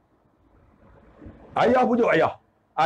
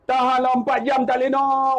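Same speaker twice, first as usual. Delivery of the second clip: ordinary talk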